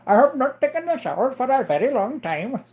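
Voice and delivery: high-pitched put-on Indian accent. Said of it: "an Indian guy".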